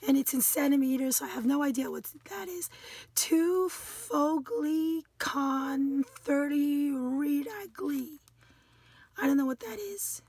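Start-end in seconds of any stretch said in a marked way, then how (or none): 3.2-8.2 very slow, sounding out each word; halting foreign-word reading. Real, not acted. Crafter reading foreign instructions she doesn't know, halting. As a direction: puzzle slowly through unfamiliar foreign words.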